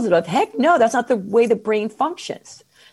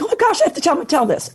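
higher eager mock gasp; excited thought; 8 semitones higher, faster than usual. Guest telling host her eager inner reaction. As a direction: blurt it like an eager realization. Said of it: "I was like".

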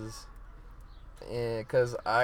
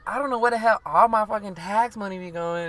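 put-on [name]'s Dominican New York accent, high and loud; imitating [name]; 9 semitones higher, 8 dB louder than usual. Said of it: "the way in her accent and shit".

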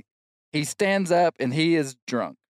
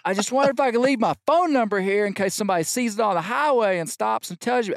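high raised voice over a crowd; yelling; 9 semitones higher, faster than usual. Retold or imitated. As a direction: shout it to the bartender. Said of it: "I'm yelling".

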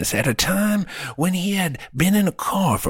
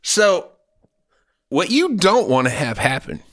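usual speaking voice

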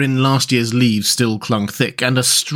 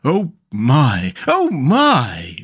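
gasped amazement, rising on the repeat; wondering gasp; much slower than usual. A narrator's reading. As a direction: marvel at each new discovery. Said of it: "still gasping".

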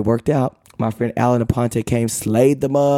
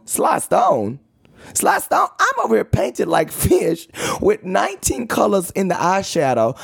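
high, mock-indignant protest; offended disbelief; 7 semitones higher than usual. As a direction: repeat it as if insulted. Offended reaction to the comparison.

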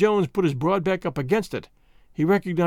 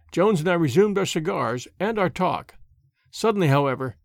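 usual speaking voice

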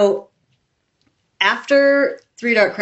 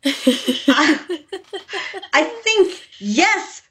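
giddy disbelief; 10 semitones higher, much slower than usual. Retold or imitated. Torn over accepting the offer.

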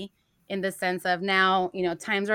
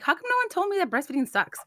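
much higher, outraged disbelief; exasperated complaint; 11 semitones higher than usual. Retold or imitated. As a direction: cry out at the unfairness. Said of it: "I'm like".

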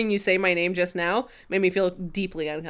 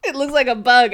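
higher, louder take on a gangster moll; gangster girlfriend voice; 5 semitones higher, 6 dB louder than usual. Retold or imitated. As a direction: imitate a gangster's girlfriend, lightly. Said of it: "does this gangster girlfriend voice".

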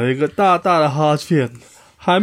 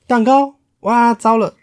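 higher, louder sudden alarm; alarmed bird; 8 semitones higher, 4 dB louder than usual. Acted. The bird, after saying cake next to the lion, suddenly alarmed. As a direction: gasp as you realise the blunder.

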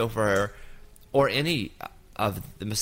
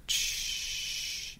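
water shush; 10 dB quieter than usual. Retold or imitated. Imitating massage water sounds.

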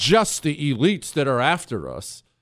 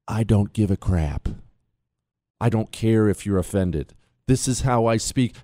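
defiant, pitched down low, close to the mic; low, confiding; 6 semitones lower than usual. Real, not acted. To listeners who might be offended, low and defiant. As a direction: drop the voice low and lean in to say it. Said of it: "put your ear closer to the speaker".

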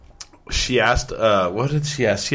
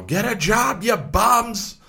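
mock yell; 11 semitones higher, much slower than usual. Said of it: "I'm all".

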